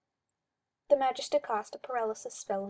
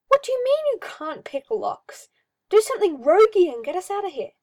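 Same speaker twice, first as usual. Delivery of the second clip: loud, high and fast, demanding; frantic shouting; exasperated yell; 8 semitones higher, 10 dB louder than usual. Acted. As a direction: shout at the useless archer to do something. Hawke yelling at Sebastian in cell.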